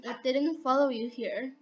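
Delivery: ordinary talk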